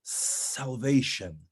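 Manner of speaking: "salvation" with a long, held opening "s"; meditative SALVATION. Shown as a demonstration. Coach recalls meditating on key word, slow weighty "salvation". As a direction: dwell slowly on "salvation". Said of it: "meditation on the specific important words".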